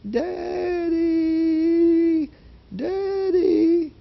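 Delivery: very high, thin imitated wail; little voice. Daughter calling her father in the night, little high wailing voice. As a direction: stretch it out as a tiny distant cry. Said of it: "the little voice said".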